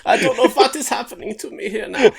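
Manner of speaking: loud, exasperated mock German accent; hammed-up German accent; stuck in German accent. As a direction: complain in a thick German accent. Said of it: "It's still going very German".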